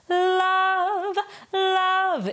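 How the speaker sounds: "love" sung high with a bright tongue-tip L. Shown as a demonstration. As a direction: let the L of "love" ring bright. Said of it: "bring a brightness".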